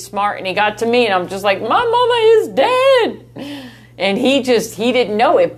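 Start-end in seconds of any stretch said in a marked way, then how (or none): 1.6-3.1 much higher and louder, a flat mock announcement; imitating her younger self's high, loud retort; blunt comeback. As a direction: fire it back high and loud to stop the joke. Retold blunt comeback to joke.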